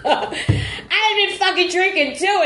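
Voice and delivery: slow, high laughing words. Real, not acted. Guest admitting her drinking, laughing. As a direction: laugh it out slowly.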